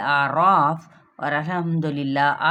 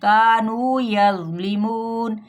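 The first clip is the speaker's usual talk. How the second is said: slow, louder, melodic tilawat; Quran recitation; 3 semitones higher, 4 dB louder, much slower than usual. Acted. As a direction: recite the verses slowly, chanting. (तिलावत के अंदाज़ में पढ़ना)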